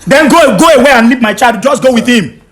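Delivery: loud, high, angry shouting; furious dismissal. Real, not acted. Husband telling wife to leave without child, furious dismissal. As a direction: shout her out of the house.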